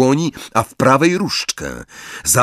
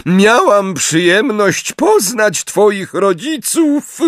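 much higher, slow gloating voice; vengeful Bellatrix; 10 semitones higher, 3 dB louder, much slower than usual. A narrator's reading. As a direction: savour every word spitefully. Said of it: "powtórzyła Bellatrix z ohydnym, mściwym uśmiechem". (wysoki, mściwy, powolny głos Bellatrix)

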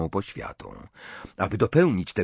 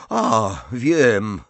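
ordinary delivery, nothing marked

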